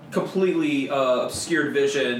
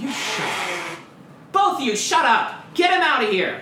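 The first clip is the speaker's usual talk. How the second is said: much higher, louder barked orders; angry shout; 12 semitones higher, 6 dB louder than usual. Acted. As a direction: yell at both of them. Boss yelling at bickering henchmen.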